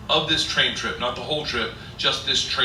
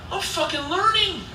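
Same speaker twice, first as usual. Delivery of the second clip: very high, giddy delight; acted high-pitched excitement; thrilled realization. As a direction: squeal it like a proud beginner. Retelling his thrilled realization.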